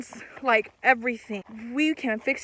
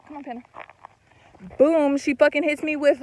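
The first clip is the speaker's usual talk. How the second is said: ordinary talk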